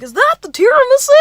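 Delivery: loud, fast, squealed mock excitement; overzealous Bill Cosby. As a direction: blurt it high like an overeager date. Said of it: "Why is he selling Bill Cosby".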